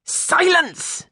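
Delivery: very high indignant cry of "Silence!". A narrator's reading. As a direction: slam down the noise. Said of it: "continued the master indignantly".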